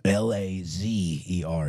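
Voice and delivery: slow, careful spelling; each letter spelled out slowly; over enunciating. Shown as a demonstration. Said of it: "One more time slowly".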